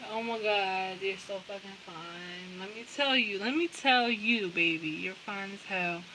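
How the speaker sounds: soft, smitten murmur; quietly acted swoon; hushed admiration. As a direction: say it softly, like a besotted admirer.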